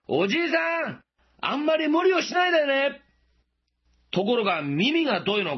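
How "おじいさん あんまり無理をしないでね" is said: high, raised call to someone far away; louder call. Retold or imitated. Passerby calling louder to a farmer who doesn't answer, high raised call. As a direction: raise your voice so the farmer hears. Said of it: "もっと大きい声で言いました".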